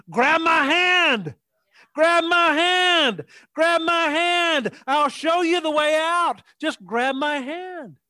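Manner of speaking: high, drawn-out rescuer's shout; urgent calling out. Acted. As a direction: call out slowly and high to someone in trouble. Acts God calling out to rescue.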